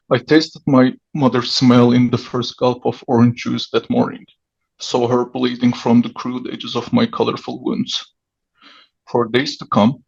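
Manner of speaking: ordinary talk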